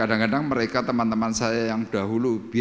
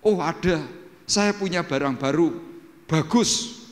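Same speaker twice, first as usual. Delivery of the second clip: higher-pitched acted salesman's reply; acted enticing offer, voice lifted; playing along; 7 semitones higher than usual. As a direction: tempt him with new goods. Acts himself luring the friend.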